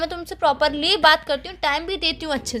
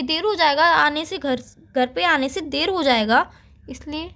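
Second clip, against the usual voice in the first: ordinary talk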